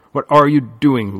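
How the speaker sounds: loud, sharp demand; angry demand. A narrator's reading. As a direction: confront him loudly. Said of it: "Lily demanded".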